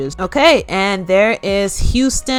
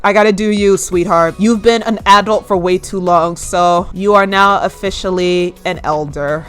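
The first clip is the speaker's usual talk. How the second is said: ordinary talk, nothing marked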